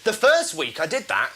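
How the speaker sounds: normal talking voice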